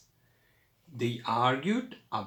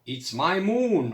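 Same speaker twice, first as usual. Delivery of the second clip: higher, louder mock roar; 6 semitones higher, 6 dB louder than usual. A narrator's reading. Ziki roaring his claim, hammed-up roar. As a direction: bellow it out for the children. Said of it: "roared Ziki".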